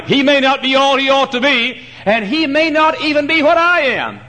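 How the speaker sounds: mock boast. Mock boast about Brother Fisk.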